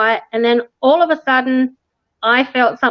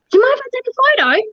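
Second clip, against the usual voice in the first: much higher cheeky ask; mock polite request; 13 semitones higher, much faster than usual. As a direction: sweetly ask permission. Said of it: "Mike asks".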